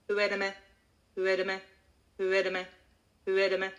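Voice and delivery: "Duerme" on one level note, syllables run together; unstressed, monotonous "duerme" drill; monotone, as one word. Shown as a demonstration. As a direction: repeat "duerme" evenly, no syllable stressed. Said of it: "It's gonna be very monotonous".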